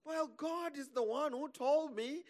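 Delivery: high, slow defensive retort; mock protest. Dreamer answering doubting family, high mock protest.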